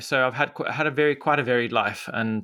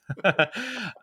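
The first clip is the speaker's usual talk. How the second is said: laugh; 11 semitones higher than usual. Real, not acted. Laughs at own coding claim.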